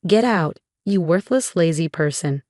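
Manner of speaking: usual speaking voice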